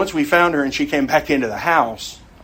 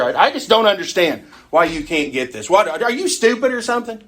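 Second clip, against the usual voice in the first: exasperated, climbing higher into a scornful jab; mock exasperation; 6 semitones higher than usual. Teacher mocking a frustrated believer scolding someone, exasperated. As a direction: play the impatient teacher losing it.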